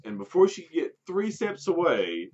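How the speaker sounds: ordinary delivery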